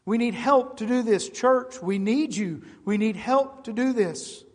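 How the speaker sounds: fervent pulpit appeal; preaching at full force. Acted. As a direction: build the plea to the church from the pulpit. Preacher exhorting the congregation.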